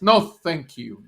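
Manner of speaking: loud, slow, firm "no thanks"; emphatic retold refusal. Tourist refusing a taxi driver's tunnel offer, emphatic. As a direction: turn down the offer firmly, drawing it out.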